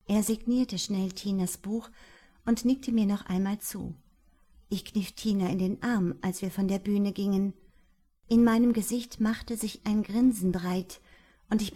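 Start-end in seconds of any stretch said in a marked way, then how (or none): none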